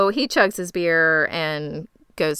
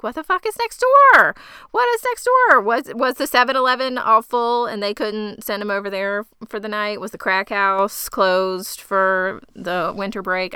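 incredulous outburst; 2 semitones higher, 3 dB louder than usual. Retold or imitated. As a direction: blurt it out in shocked disbelief. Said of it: "And I was like".

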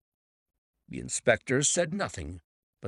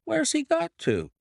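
high-pitched, baffled question; puzzled cry; 3 dB louder than usual. A narrator's reading. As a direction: exclaim it, baffled at someone vanishing. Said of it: "cried the inspector".

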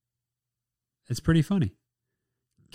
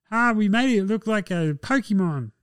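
high, put-on silly voice; mocking dog owner; 8 semitones higher than usual. Dog owner showing off the Pokemon dog, mocking. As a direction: mimic a pleased fool, pitched way up.

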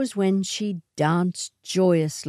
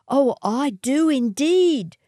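higher, louder, sugary false agreement; fake sincerity; 9 semitones higher, 4 dB louder than usual. A narrator's reading. Matilda answers the swagman with fake sincerity. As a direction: gush insincerely. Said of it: "with all the fake sincerity".

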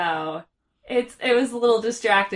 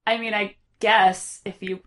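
lower, softer, bored, fading; can't-be-bothered sigh of a line; apathetic drone; 5 semitones lower, 5 dB quieter than usual. Retold or imitated. Host voicing Morla as utterly uninterested. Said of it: "And she's just like".

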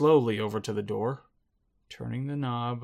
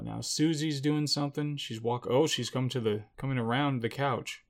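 ordinary talk

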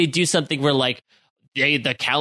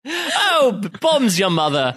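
mock British accent; 5 semitones higher, slower than usual. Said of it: "British impersonating".

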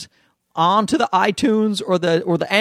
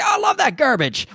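higher, shouted mock enthusiasm; mock gushing kid; 8 semitones higher than usual. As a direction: gush like an eager fan kid. Kids gushing over his videos.

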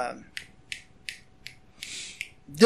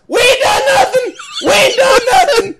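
mock panicky yell; 14 semitones higher than usual. Friend yelling at the cop, mock panicked shout. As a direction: yell it like you're innocent, hands up. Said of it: "He's yelling".

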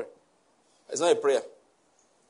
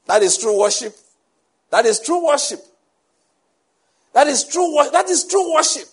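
each repeat higher and louder; building emphasis; 7 semitones higher, 5 dB louder than usual. Real, not acted. Preacher hammering his point home to the congregation, rising. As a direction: climb with every repeat until it rings.

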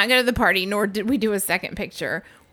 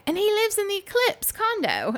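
imitating British guy; 12 semitones higher than usual. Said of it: "And he's like".

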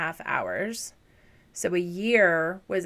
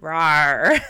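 playful dinosaur roar; loud little rawr; 2 semitones lower, 10 dB louder than usual. Pretend. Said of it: "let me make a dinosaur noise".

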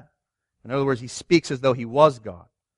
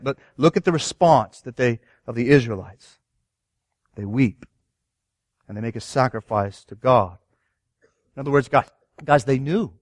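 ordinary delivery

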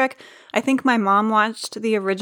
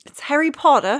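put-on failed British accent; 6 semitones higher, 3 dB louder than usual. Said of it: "How come I can't say it?".

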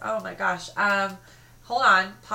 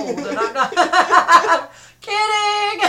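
high, loud, slow laughing; cracking up on the words; 11 semitones higher, 13 dB louder, much slower than usual. Real, not acted. Laughing at joke.

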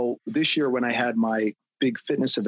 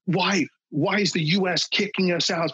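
higher, mocking voice of protesters; mock protester whine; 6 semitones higher than usual. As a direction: parody their complaint, pitched up. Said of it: "and they're like".

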